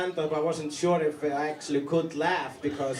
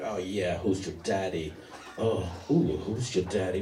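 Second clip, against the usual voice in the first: much lower, sultry mock bedroom voice; movie lover; 11 semitones lower, 3 dB quieter than usual. Comic imitating a man in a movie love scene, low and sultry. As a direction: drop low and purr like a film lover. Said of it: "they're going like".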